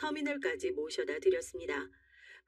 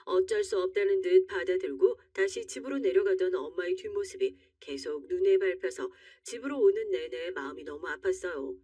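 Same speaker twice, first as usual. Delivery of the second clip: usual speaking voice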